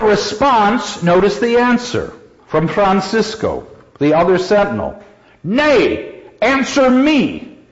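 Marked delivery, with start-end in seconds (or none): 5.4-7.6 high, slow demanding challenge; a guard answering a challenge with a challenge; sentinel's retort. Acted. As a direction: throw the question back at him. Teacher voicing Francisco's reply.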